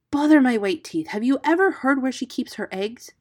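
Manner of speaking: cross, urgent hero's voice; impatient. A narrator's reading. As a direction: brush off flattery and press on. Hero brushes off flattery.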